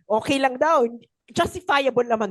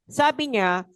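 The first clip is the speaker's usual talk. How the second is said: normal talking voice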